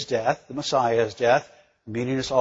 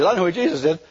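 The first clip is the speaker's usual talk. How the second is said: usual speaking voice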